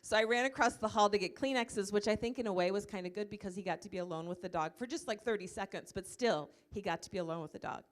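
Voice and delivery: ordinary talk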